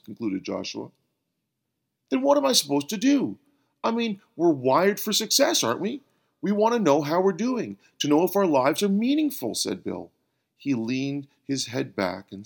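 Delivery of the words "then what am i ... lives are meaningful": high, loud, rushed plea; plead, exasperated and fast; frustrated pleading. Acted.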